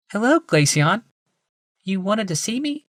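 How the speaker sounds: a polite wife, lifted and high; Rebecca. A narrator's reading. Wife greeting her husband, high woman's voice.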